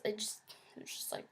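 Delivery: hushed whisper, fast at first. Real, not acted. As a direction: breathe the words as dread creeps in. Dread creeping back.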